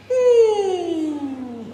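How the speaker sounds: high, loud falsetto ooh; a funny, high hoot for the kids. Shown as a demonstration. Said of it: "get the kids to do this bit funny".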